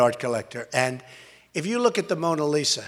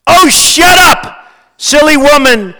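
loud, high recited put-down; grinning scorn; 9 semitones higher, 16 dB louder, slower than usual. A narrator's reading. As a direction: declaim the snake's gleeful dismissal, punching it out. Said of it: "said the reptile with a grin".

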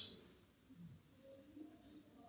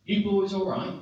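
raised, higher call across water; 6 semitones higher, 4 dB louder than usual. Retold or imitated. Friend's dad calling from the rescue boat, calling out. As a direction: call out to check on them.

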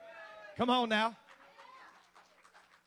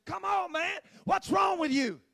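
much higher and louder, a yell across the field; softball field yell; 10 semitones higher, 9 dB louder than usual. Retold or imitated. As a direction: scream at a teammate who's slacking. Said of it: "they start in screaming".

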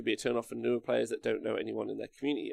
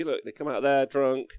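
ordinary delivery

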